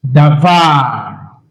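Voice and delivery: davar, low-pitched, with a drawn-out final VAR; stress on last syllable. Shown as a demonstration. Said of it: "on this word is on the last syllable".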